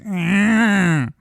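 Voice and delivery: drawn-out, high mocking "aah"; high mock moan; mocking vocal imitation. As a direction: mimic her voice with a stretched whine. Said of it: "even the way she talks".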